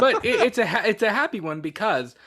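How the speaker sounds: giggling insistence that it really is happy news; laughing. Real, not acted. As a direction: laugh your way through the protest. Laughing while defending happy topic.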